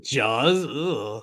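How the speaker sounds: understated disgust with a soft "ugh"; mock disgust. Host mimicking a co-host's answer, mock disgust. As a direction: imitate a disgusted questioning reply, ending on "ugh". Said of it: "You said it, like, with disgust".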